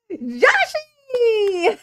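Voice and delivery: high, loud laughing cry of a name; excited squeal. Real, not acted. Excited greeting to first visitor.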